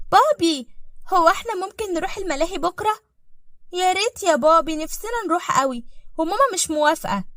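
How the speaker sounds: put-on high-pitched little kid voice; pleading child. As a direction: beg Daddy like an eager child. Kinder bitten Papa um Ausflug.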